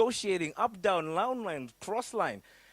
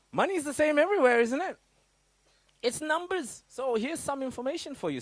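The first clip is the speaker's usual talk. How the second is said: excited; 10 semitones higher, 4 dB louder than usual. Real, not acted. Excited point to audience.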